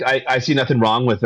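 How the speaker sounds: ordinary talk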